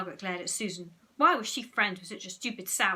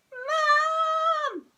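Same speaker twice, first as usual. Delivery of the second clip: very high loud wail; 10 dB louder than usual. A narrator's reading. Peter wails for Mum, high and loud. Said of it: "wailed Peter".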